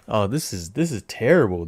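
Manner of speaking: ordinary talk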